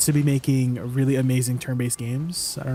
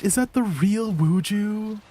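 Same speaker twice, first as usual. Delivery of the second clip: higher, slowed disbelief; drawn-out surprised question; 6 semitones higher, slower than usual. Real, not acted. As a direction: ask it slowly, not believing your eyes. Spots a surprise guest in chat.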